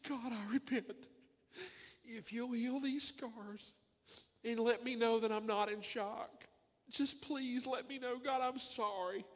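slow, high, broken pleading; pleading prayer. Retold or imitated. Preacher repeating his desperate hospital prayer, slow and pleading. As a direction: beg God slowly, close to tears.

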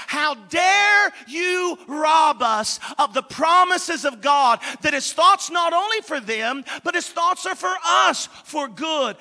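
loud preacher's outrage, slow on the first words; indignant anger. Acted. Preacher rebuking the radio scholars, indignant shout. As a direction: cry it out, half mad and half anointed. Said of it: "I'm half mad and half anointed".

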